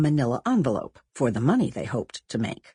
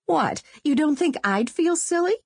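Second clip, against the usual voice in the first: put-on higher girl's voice, indignant rise on think; Sonia protesting; 11 semitones higher than usual. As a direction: push back at your friend. Girls arguing over who asks.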